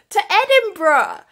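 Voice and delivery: loud, high reveal of the destination; excited exclamation. Real, not acted. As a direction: announce it like a big surprise.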